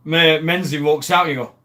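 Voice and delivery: ordinary talk, nothing marked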